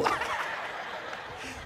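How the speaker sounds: high unvoiced laugh; breathy laugh. Real, not acted. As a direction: laugh under your breath. Laugh after joke.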